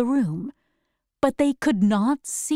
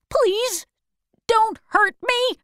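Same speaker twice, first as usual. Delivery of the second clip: much higher, a mock-frightened plea; pleading; 12 semitones higher than usual. Old wizard begging the Woodman not to hurt him, pleading. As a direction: beg not to be hurt, voice jumping up.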